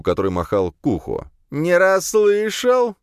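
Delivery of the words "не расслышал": loud, high, slow yell; drunken shout. A narrator's reading. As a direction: shout it mockingly. (пьяный крик)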